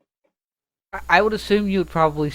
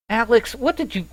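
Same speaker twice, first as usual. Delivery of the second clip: frustrated call out; 10 semitones higher than usual. Acted. Julia yelling at Alex in the back, frustrated. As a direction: call out in annoyance, cut yourself off. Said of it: "the frustrated sound".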